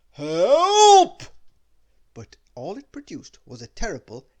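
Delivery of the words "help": Help! bellowed much higher and far louder; huge shout. Acted. Mister Noisy yelling Help! for everyone, huge bellow. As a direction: yell Help! at full lungs. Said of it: "shouted at the top of his very, very loud voice".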